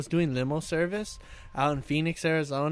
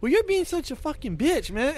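exasperated retort; 10 semitones higher, 3 dB louder than usual. Retold or imitated. Retold quarrel with passenger.

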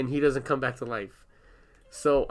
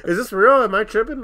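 higher, louder disbelieving questions; incredulous asking; 7 semitones higher, 8 dB louder than usual. Retold or imitated. Asking coworkers at McDonald's, higher and disbelieving. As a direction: plead for confirmation.

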